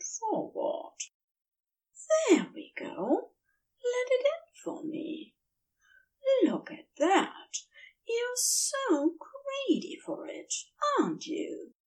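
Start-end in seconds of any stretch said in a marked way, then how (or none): 2.3-11.7 soft, hushed and soothing, partly whispered; soothing tones. A narrator's reading. Angel coaxing his lover to relax, soft soothing murmur. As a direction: coax him gently, barely above a breath. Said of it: "in his most soothing tones".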